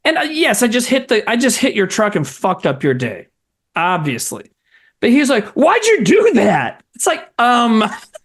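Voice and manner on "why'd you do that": high, drawn-out retold demand; upset young man. Crash victim confronts the driver who hit his truck, mock upset. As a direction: imitate an upset stranger, pitched up and slow. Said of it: "he was so fucking upset at me".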